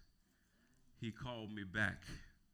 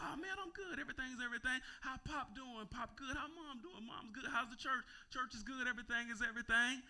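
high, breezy show of being fine; retold younger self; 13 semitones higher than usual. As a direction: brush it off high and chirpy.

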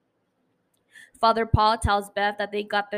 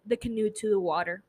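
ordinary talk

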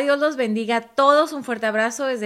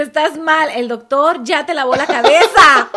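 raised, loud accusation through laughter; mock scolding; 3 semitones higher, 7 dB louder than usual. Reading out a husband's accusation to wife, mock scolding. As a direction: scold loudly, half laughing.

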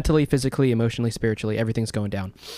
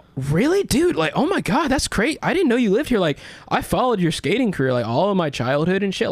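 much higher, breathless fanboy gushing; 8 semitones higher than usual. Retold or imitated. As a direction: pour it out like a starstruck kid. Retelling gushing to celebrity.

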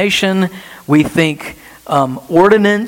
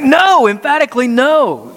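higher, forceful double NO; emphatic no; 8 semitones higher than usual. Retold or imitated. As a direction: slam the NO as a firm refusal. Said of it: "He's like".

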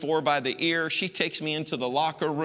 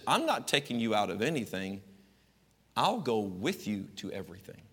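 soft mom; 5 semitones lower, 5 dB quieter than usual. Retold or imitated. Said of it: "my mom's philosophy".

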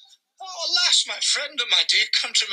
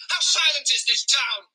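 usual speaking voice

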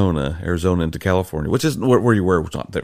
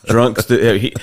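laughing; 5 semitones higher, 7 dB louder, slower than usual. Real, not acted. Laughing at drunk judge.

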